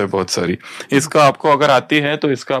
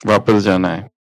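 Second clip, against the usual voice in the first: guided meditation; 5 semitones lower than usual. Acted. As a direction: lead them gently back inward. Guides meditation back home. (धीमी, गहरी ध्यान कराने वाली आवाज़)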